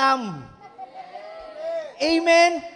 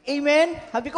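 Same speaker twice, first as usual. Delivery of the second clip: ordinary talk, nothing marked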